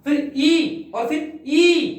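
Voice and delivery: ई stretched long and slow; slow, drawn ई demo; drawn-out long vowel. Shown as a demonstration. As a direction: hold the ई out to show a long vowel. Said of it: "यहाँ आ गया आ".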